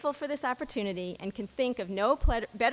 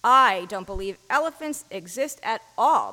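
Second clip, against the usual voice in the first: declaring; 9 dB louder than usual. A narrator's reading. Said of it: "declared the sixth blind man".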